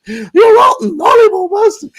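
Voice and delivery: high shrieking horror villain; Carry On villain voice. Retold or imitated. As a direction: screech it like a ham villain. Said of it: "odd bod from Carry On Screaming".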